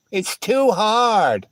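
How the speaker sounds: slow whiny mock of the excuse; slow, mocking whine. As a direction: drag it out like a lazy excuse.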